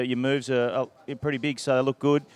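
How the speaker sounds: usual speaking voice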